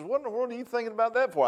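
a hint of a puzzled mother, quick; mom's voice. Mother asking her crying son why, lightly voiced. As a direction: ask it fast like a confused mama. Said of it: "she says".

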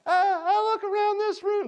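high squeaky puppet voice; high-pitched cartoon puppet impression; Pinocchio voice. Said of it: "That's how he talked".

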